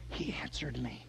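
very soft, low murmur; quiet, low wonder; hushed awe. Acted. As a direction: say it under your breath, barely believing it. Hushed awe, then repeated louder.

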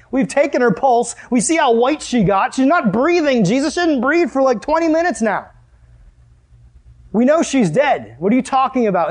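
high, agitated protest; incredulous mourners. Acted. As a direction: argue back in scoffing disbelief, pitched high. Mourners argue with Jesus, mocking.

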